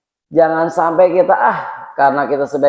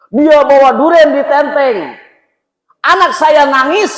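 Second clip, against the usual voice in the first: high, loud aggrieved complaint; hammed-up accusing outcry; acted grievance; 10 semitones higher, 4 dB louder than usual. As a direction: pour out the grievance at high pitch.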